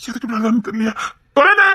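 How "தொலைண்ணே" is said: high, loud call for someone; urgent raised-voice summons. Acted. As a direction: call out sharply, pitch rising at the end. Calling out for another man.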